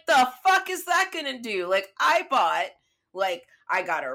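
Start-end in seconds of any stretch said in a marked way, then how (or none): 0.1-1.7 loud, high re-enacted protest; retold indignation. Traveller scoffing at airline's call-here slip, retold indignation. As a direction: throw it back in mock outrage.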